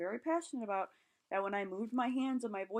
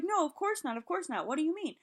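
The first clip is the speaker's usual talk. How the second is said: shocked denial; 7 semitones higher, 4 dB louder than usual. Retold or imitated.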